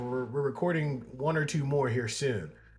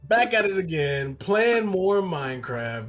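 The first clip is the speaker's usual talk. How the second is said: higher, louder hyped gamer intro; YouTuber voice; 4 semitones higher, 4 dB louder than usual. Retold or imitated. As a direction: pump up the viewers like a kids' gaming channel. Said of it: "It's like".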